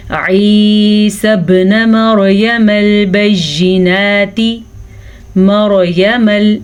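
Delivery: slow, drawn-out tajweed recitation; Quran recitation. Shown as a demonstration. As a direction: chant each word slowly. Recites the verse.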